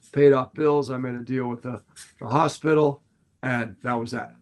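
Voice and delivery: normal talking voice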